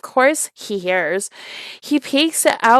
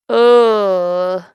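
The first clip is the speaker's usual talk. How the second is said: disgusted groan; 6 dB louder than usual. Real, not acted. Disgust at drink.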